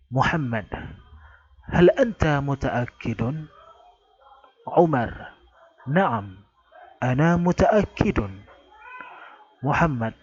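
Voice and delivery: a teacher voicing both speakers of the dialogue; model dialogue reading. Shown as a demonstration. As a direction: read each line as the model for students to copy. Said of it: "sesuai dengan intonasi".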